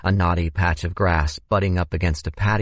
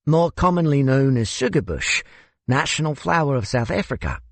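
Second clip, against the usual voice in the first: higher-pitched South African host; South African accent; 8 semitones higher than usual. Acted. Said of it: "I couldn't place your accent".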